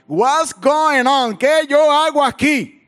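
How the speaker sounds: loud, high, drawn-out plea; slow, hammed-up wail of protest; desperate cry to God. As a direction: cry out to heaven in acted bewilderment. Acts his cry to God.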